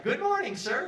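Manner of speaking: high, sugary stewardess greeting; mimicking stewardess. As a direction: chirp it up high like the friendly stewardess.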